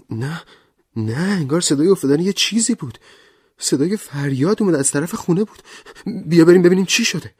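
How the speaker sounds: high, fast, rising panic; alarmed. Acted. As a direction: she hurries him, frightened and loud. Vera alarmed by a crash.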